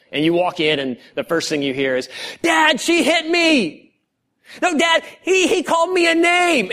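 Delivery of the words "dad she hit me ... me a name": put-on whiny kids. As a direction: whine like a kid tattling to Dad. Kids tattling to dad.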